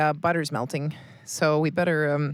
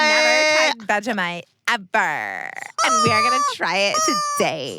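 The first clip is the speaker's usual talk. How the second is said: loud, soaring presenter shout; over-the-top announcer voice; mock show intro; 7 semitones higher, 6 dB louder than usual. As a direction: hype it up absurdly high. Hammed-up pretend show intro.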